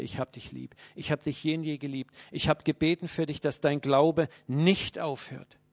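normal talking voice

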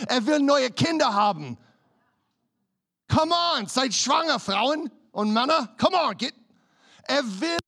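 loud, high, urgent preacher; fiery preaching. Acted. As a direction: preach it to the congregation. Preaching at full force.

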